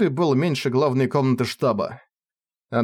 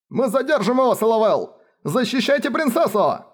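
shouting; 10 semitones higher than usual. Acted. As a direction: shout to the comrade from afar. Soldier shouting from the room.

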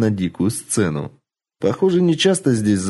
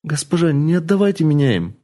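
pleading; 6 semitones higher than usual. Acted. Girl keeps pleading.

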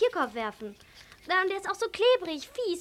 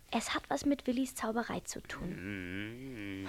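slow low whisper of a secret suspicion; 10 semitones lower, 7 dB quieter than usual. Acted. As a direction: breathe it like a secret. Girl suspects magic, confides.

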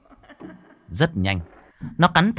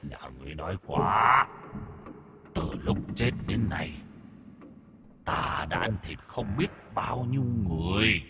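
soft, hushed demon voice; menacing vengeful ghost; 8 dB quieter than usual. A narrator's reading. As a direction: threaten softly, like a ghost toying with intruders. (giọng quỷ khẽ, đe dọa)

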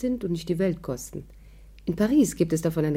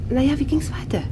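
whisper; 8 semitones higher than usual. Acted. Eager to hear the story.

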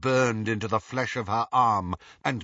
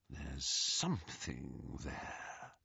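slow, low, hushed discovery; whispered; 8 semitones lower, 15 dB quieter, much slower than usual. A narrator's reading. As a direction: whisper it very slowly. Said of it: "he whispered".

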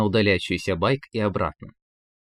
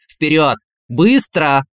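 loud, sharp urgent orders; serious urgent command; 5 semitones higher, 8 dB louder, slower than usual. Acted. Inner voice orders Valentin to save the girl, urgent. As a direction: push him forward, no nonsense. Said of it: "голос стал серьёзен".

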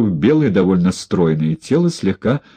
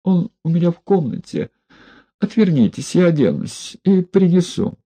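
raised, frightened woman's voice; timid woman's voice; 9 semitones higher than usual. A narrator's reading. Испуганная женщина просит разрешения одеться.

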